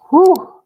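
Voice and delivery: a sharp, high whistling whew of relief; loud high-pitched exhale of relief. Real, not acted. Reacting to memory of juvenile school.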